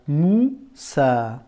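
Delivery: Moussa slowly split into mou - saa; découpé en syllabes. Shown as a demonstration. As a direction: sound out Moussa in two separate syllables. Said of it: "on entend ça". (Moussa détaché en deux syllabes)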